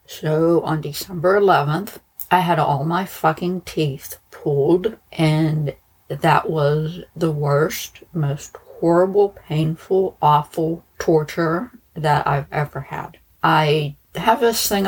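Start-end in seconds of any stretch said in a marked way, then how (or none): none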